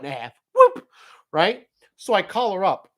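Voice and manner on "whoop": sudden high yelp of surprise; startled whoop. Retold or imitated. Telling of sliding on ice, a loud startled whoop. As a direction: yelp as the car slides out.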